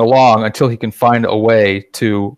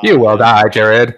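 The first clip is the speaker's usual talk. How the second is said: louder flat robot voice; 4 dB louder, much slower than usual. Retold or imitated. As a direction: intone it like a menacing robot. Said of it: "it was the robotic voices".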